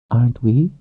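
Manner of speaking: lightly voiced young woman; woman's voice. A narrator's reading.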